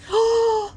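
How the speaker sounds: high, sharp gasp; real gasp of sudden realisation; shocked gasp. Real, not acted. Streamer hit by a plot twist, shocked gasp.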